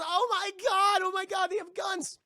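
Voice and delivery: high, loud panicked shout; mock panic. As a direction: scream it as a terrified crowd. Everyone reacts to guns.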